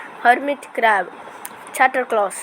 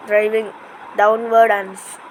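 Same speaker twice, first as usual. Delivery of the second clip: usual speaking voice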